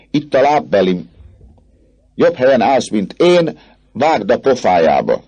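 boisterous goading; rowdy egging on. Acted. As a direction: urge a friend to hurl it. Student urges throwing shoe.

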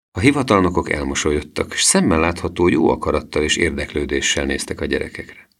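plain reading voice

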